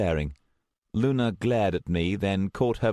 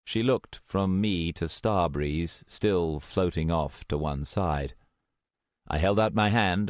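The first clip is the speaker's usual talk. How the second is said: ordinary delivery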